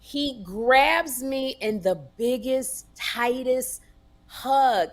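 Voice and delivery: usual speaking voice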